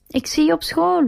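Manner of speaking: high-pitched call after her. A narrator's reading. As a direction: call out to the leaving car. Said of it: "roept Truman".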